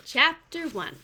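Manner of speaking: plain reading voice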